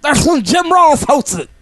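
high, loud, slow wrestling hype; mock promo shout. Riffing on the wrestler's promo, high hammed-up shouting. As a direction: bellow it like a hammy wrestler cutting a promo.

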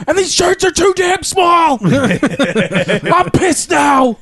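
high, loud, drawn-out mock wrestler rant; struggling wrestler promo. Retelling Lex Luger's botched promo, high angry shouting. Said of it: "he's just struggling. He's like".

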